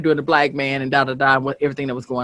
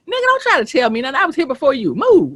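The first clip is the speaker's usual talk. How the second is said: fired-up, higher-pitched impersonation; Dick Gregory; 6 semitones higher than usual. Acted. Co-host acting out Dick Gregory telling a man off, high-pitched impression. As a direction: rattle it off high, like an elder putting someone in his place. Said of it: "and this is Dick".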